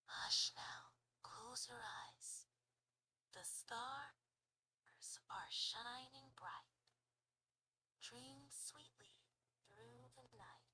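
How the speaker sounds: very slow, hushed whisper; whispered lullaby. Shown as a demonstration. Giving the user a short lullaby, slow whisper. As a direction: whisper someone to sleep, trailing into breath. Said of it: "but whisper it?".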